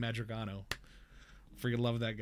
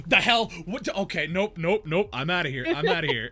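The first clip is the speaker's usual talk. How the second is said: louder, higher nope-ing out; mock alarmed refusal; 5 semitones higher, 8 dB louder than usual. Streamer retelling his refusal of a creepy client, loud mock panic. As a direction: back away fast, mock horrified. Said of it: "And I'm like".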